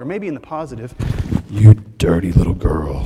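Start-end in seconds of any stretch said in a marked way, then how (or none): 1.5-3.0 much lower, louder and slow, hammed up; mock naughty line. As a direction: lay it on thick like a cheesy flirt. Mock naughty quote about dirty.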